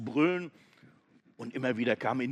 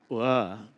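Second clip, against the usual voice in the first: disgusted groan; 7 dB louder than usual. Acted. Goat chews bone.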